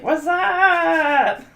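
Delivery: high, loud, laughing whassup; a mock whassup through laughter; Scary Movie whassup. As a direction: belt it out like the Scary Movie guy. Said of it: "you know how he's like".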